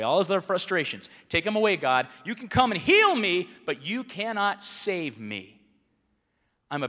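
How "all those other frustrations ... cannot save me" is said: defiant shout. Acted. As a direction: shout it at God, then drag the refusal. Voicing the demanding thief.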